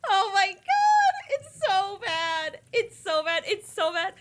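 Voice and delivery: very high, loud, slow wail of disgust; drawn-out cry of horror; distressed wail. Real, not acted. Gamer stuck among bugs, loud anguished wail. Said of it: "This is like torture for me".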